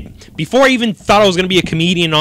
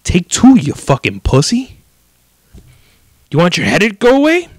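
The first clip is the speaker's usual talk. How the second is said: loud, higher, fed-up snap; exasperated girlfriend; 4 semitones higher, 5 dB louder than usual. Retold or imitated. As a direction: bark it at a wimpy boyfriend. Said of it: "she just looks at me and goes".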